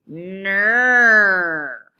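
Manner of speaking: droning mock plane engine; kid's airplane drone; airplane noise. A narrator's reading. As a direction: hum it like a toy airplane. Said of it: "made an airplane noise".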